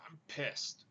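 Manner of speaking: hushed, seething whisper; barely voiced angry whisper; pissed-off whisper. Real, not acted. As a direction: whisper it through held-back anger. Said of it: "as you can see".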